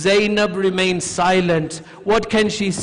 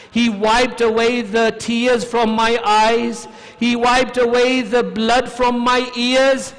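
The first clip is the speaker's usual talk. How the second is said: high, raised, grieving recital; impassioned lament; 6 semitones higher, 5 dB louder than usual. Retold or imitated. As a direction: dwell on it with aching intensity. Child tells dream in lament.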